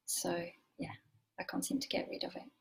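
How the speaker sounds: soft breathy self-deprecating aside; whispered aside. Real, not acted. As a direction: drop to a whisper, half to yourself. Wry aside about her accent.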